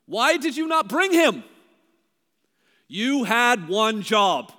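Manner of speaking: loud exasperated rebuke of the officers; indignant scolding. Retold or imitated. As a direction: chew them out, loud and fed up. Chief priests scolding the officers.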